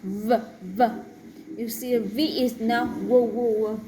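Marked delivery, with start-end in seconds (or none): 2.9-3.8 wo with rounded lips, no teeth; W instead of V; wrong W sound. Shown as a demonstration. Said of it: "It's never the W sound".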